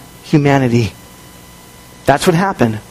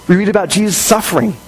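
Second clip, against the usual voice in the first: ordinary talk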